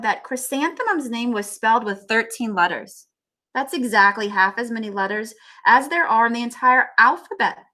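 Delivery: read-aloud storybook voice; story narration. A narrator's reading. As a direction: read on to the class. Teacher reads book aloud.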